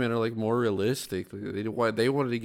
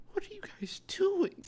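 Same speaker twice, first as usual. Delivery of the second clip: high whispered question; hushed, puzzled whisper; 6 dB quieter than usual. Real, not acted.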